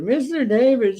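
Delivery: high Mister David, falling on David; imitating the old lady. Pest guy repeats the customer's call, high-pitched. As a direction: say his name again her way, up high. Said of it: "That's the way she says it".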